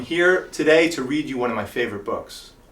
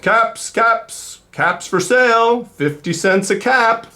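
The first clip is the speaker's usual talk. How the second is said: high, slow street-seller call; calling out wares; 10 semitones higher, 7 dB louder, slower than usual. Acted. Peddler calling down the street, high slow call. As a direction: call it out like a peddler selling caps. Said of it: "he called".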